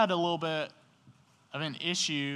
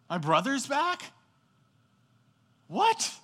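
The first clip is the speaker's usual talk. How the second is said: high, loud burst of disbelieving joy; thrilled shout, can't believe the news; excited; 12 semitones higher, 9 dB louder than usual. Pretend. Older brother hearing his brother is back, thrilled and loud. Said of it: "instead of being excited about that".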